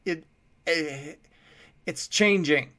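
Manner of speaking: a scared man calling up, tripping on words; stammering fear. A narrator's reading. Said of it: "called back".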